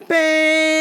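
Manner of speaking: long, loud, high horn blare; imitated car horn. Driver lays on his horn at walker, loud imitated honk. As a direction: honk one held note like a horn.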